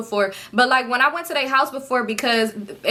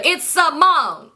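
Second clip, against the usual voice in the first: drawn out slow, louder; correcting the guy who forgot her name; exasperated; 6 semitones higher, 4 dB louder, much slower than usual. Real, not acted. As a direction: stretch it out in disbelief.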